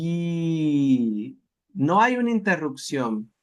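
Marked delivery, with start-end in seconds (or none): 0.1-1.4 Nikchiugi said slowly and unbroken, the sound never dropping; one flowing slow Nikchiugi; smooth model word. Shown as a demonstration. Teacher models the correct smooth form for students. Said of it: "Oiga la primera".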